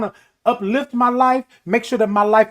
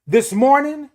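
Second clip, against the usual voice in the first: usual speaking voice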